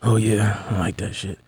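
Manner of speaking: low, put-on caller voice; sleazy phone caller. Host plays the guy calling the sexy line, low mock-lusty voice. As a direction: play a turned-on caller, dropping low. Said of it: "I'm be the guy calling in".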